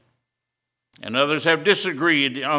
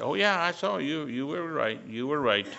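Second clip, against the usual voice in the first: quiet agreement; 7 dB quieter than usual. Retold or imitated. Mild answer to worker.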